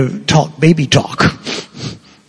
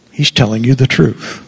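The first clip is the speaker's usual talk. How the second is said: normal talking voice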